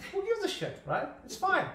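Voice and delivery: high, dismissive who-cares toss-off. Retold or imitated. Mock dismissive to a friend about accents. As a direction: brush it off lightly, voice jumping up high. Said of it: "I'm like".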